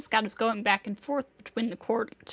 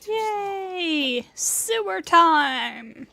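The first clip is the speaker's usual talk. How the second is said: high, louder, very slow sing-song glee; gleeful cheer; 7 semitones higher, 6 dB louder, much slower than usual. Real, not acted. Streamer cheering going into sewer.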